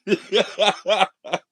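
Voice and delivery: high burst of helpless laughter; real laughter. Real, not acted. Host amuses himself after caller's joke, real high laughing fit. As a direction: crack up at your own joke.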